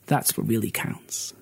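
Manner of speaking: hushed, breathy final words; whispered moral. Acted. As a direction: drop to a whisper for the lesson. Snake's closing moral.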